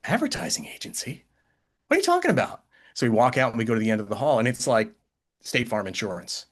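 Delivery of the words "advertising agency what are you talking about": high incredulous squeak of disbelief; a hammed-up confused boss; boss's disbelief. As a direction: boss baffled, voice jumping way up. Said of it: "And he goes".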